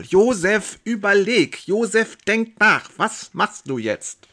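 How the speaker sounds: high, loud, slow self-address, then drops; urging inner voice. Acted. Josef spurs himself to decide, loud inner urging. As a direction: drive yourself to think it through.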